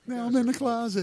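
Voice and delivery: high cartoon voice again; Tom Cruise impression. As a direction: squeak it out cartoonishly. Repeating the cartoon quote.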